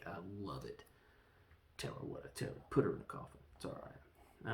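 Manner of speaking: whispered, near-silent aside to himself; half-voiced whisper; hushed aside. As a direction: breathe it under your breath. He mutters his reaction to himself.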